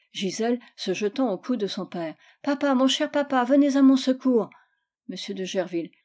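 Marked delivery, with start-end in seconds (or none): 2.4-4.5 put-on higher, louder little-girl plea; child pleading for help. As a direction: call out to papa for rescue. Girl calls on father for help. (appel au secours d'enfant gâtée)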